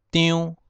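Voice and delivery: 张 as "tiunn", high level and nasal; Hokkien reading. Shown as a demonstration. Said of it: "第一声张".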